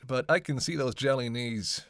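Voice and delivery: ordinary delivery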